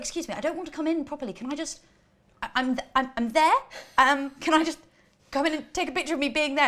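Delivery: high, loud, halting request; acted flustered plea at the door; pleading re-enactment. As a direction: haggle politely but insistently. Acts out pleading with ticket staff.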